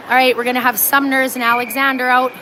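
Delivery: ordinary talk, nothing marked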